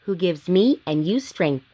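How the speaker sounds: ordinary delivery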